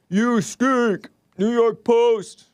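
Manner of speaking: high, loud mocking jeer, slowed at the end; mock taunt. Streamer jeering at the New York Post in a high mocking voice. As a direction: sneer it like a playground taunt.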